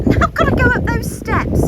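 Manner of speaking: laughing, high and loud. Real, not acted. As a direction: laugh through the line. Laughing at steep steps ahead.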